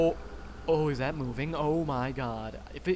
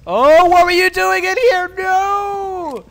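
high, very loud panicked shriek; startled scream; 16 dB louder, slower than usual. Real, not acted. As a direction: scream as if ambushed. Startled by Slenderman in game.

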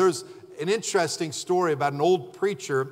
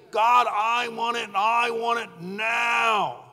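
whiny demand; 5 semitones higher, slower than usual. Retold or imitated. Mocking a whining believer.

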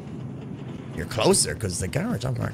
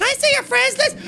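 mocking squeaky kid. Voicing a player asking.

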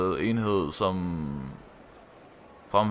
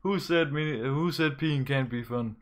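excited laughing; 5 semitones higher than usual. Real, not acted. Streamer thrilled by peeing minigame.

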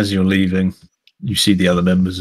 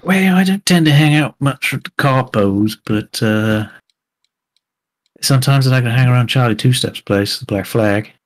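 put-on southern accent; 4 semitones higher, 4 dB louder than usual. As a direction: play the Southern fixer, easygoing. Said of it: "I can't get out the southern accent now".

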